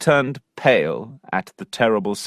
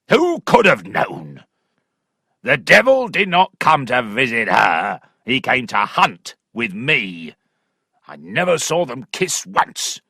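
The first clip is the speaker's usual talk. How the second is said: furious squire; 3 semitones higher than usual. Acted. Angry father shouting at Allworthy about his daughter. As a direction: roar it like a raging country squire. Weston raging threat.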